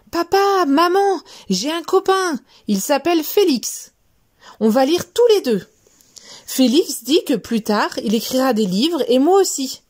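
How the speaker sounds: high, eager rush of happy news; excited news. Acted. Little mouse telling his parents about his new friend, thrilled. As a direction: burst in thrilled to tell your parents.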